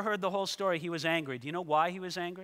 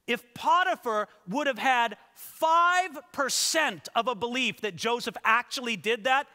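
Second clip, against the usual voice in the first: emphatic preaching; 6 semitones higher, 5 dB louder than usual. Real, not acted.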